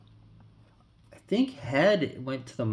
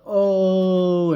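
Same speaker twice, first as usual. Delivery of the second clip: mock long, loud "ohhh"; hammed-up high holler like a breaker; breaker's hype cry; 8 semitones higher, 10 dB louder than usual. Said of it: "Just without the".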